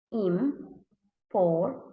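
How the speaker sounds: "important" in three slow separate beats; read by syllables. Shown as a demonstration. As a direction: pause after each syllable of "important". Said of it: "read by syllables".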